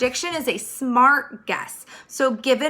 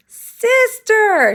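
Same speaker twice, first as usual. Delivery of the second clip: high, loud cry for sister; a scared call from the bedroom; frightened shout; 12 semitones higher, 8 dB louder than usual. Acted. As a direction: yell it in alarm. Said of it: "shouted Baby".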